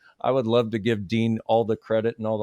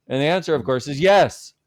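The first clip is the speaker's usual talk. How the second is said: rising, louder punchline; emphatic yes; 5 semitones higher, 6 dB louder than usual. Real, not acted. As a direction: land the "yes" hard and loud. Emphatic punchline to own joke.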